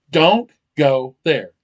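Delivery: DON'T GO THERE loud, word by word; emphatic refusal. Acted. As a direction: punch each word of "Don't go there" slowly. Acted driver's emphatic repeated answer.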